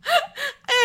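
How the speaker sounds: very high laugh falling off; squealing laugh that drops; shrieking laugh. Real, not acted. Cracking up at the story.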